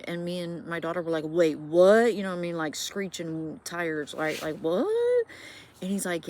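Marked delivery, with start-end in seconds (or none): 1.3-2.1 sudden loud shocked outburst; shocked wait what. Retold or imitated. Retelling the ex's comment, mother and daughter shocked. Shocked reaction during ghost story. 4.6-5.3 high, loud startled shriek; a sudden squeal of disbelief; shrieked what. Retold or imitated. Mother and daughter shocked by the ex's words, high shriek.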